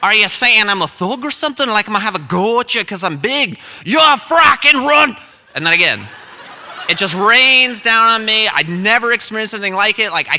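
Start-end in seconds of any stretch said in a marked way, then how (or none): 0.0-5.2 put-on Irish accent, building to a high shout; hammed-up Irish indignation; unamused Irishman. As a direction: take offense in a put-on Irish accent, escalating. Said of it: "like, not amused at all".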